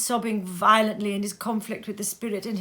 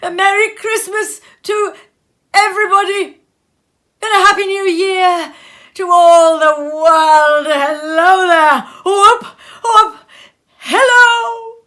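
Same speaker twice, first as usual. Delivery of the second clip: much louder and higher, whooping; joyful shouting; 9 semitones higher, 14 dB louder than usual. A narrator's reading. Scrooge hailing the whole world, whooping loudly.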